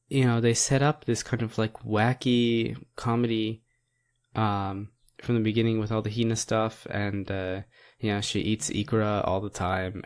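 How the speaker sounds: ordinary delivery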